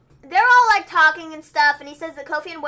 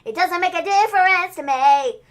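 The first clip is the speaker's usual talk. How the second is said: loud, high, drawn-out mimicry; exaggerated, stretched-out I-don't-care; mock indifferent reply; 6 semitones higher, 10 dB louder, slower than usual. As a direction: throw the promo's slow cadence back at them. Own mocking reply to the promo.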